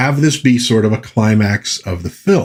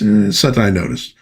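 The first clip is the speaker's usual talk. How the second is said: ordinary talk, nothing marked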